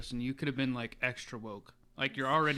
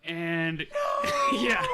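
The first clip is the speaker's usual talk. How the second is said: high, loud shrieking laughter; laughing hard; 15 dB louder than usual. Real, not acted. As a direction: crack up completely. Story punchline lands.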